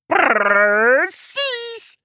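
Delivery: very high, rolled, drawn-out glee; laughing frog. A narrator's reading. Frog agreeing with the grumpy unicorn, merry. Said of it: "zei de topkikker lachend".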